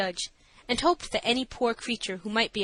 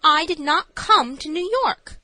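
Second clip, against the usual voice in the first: high, loud, slow insistence; incredulous protest, raised and deliberate; feigned incredulity; 7 semitones higher, 7 dB louder than usual. A narrator's reading. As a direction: act incredulous, drawing out each word. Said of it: "incredulous as I thought a crazy person".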